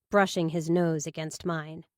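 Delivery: usual speaking voice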